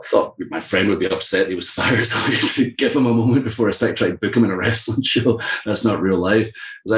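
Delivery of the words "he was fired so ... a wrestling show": laughing. Real, not acted. Joking about awkward booking after firing.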